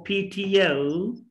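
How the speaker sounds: slow P T O as "phee-tee-yo"; drawn-out, linked P T O; say after me. Shown as a demonstration. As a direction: stretch P T O into a final "yo". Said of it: "try to say after me".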